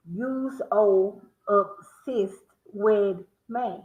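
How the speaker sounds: drawn-out syllables, word by word; slow sound-out. Shown as a demonstration. Repeating the riddle for the stream, slow. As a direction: spell the riddle out slowly. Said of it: "will high, will high".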